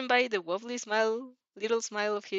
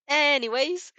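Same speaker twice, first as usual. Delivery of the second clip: chirp; 6 semitones higher, 7 dB louder, slower than usual. A narrator's reading. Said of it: "you chirp".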